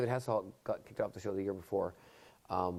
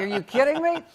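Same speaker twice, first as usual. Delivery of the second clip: panicked outburst; 8 dB louder than usual. Retold or imitated. Panicking at his own song choice.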